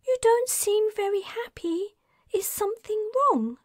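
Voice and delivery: put-on young man Darius, concerned. A narrator's reading. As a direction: check on her kindly.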